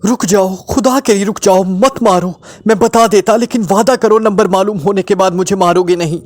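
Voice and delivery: high, panicked begging; urgent, high-pitched desperate cry; hysterical pleading. A narrator's reading. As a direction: plead frantically for your life. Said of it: "हिसियानी अंदाज में कहा".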